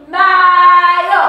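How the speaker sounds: mayo sung out loud for the class; model word for repeating. Shown as a demonstration. Teacher sings out mayo for the class to repeat. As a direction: call out mayo for them to echo. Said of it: "After me".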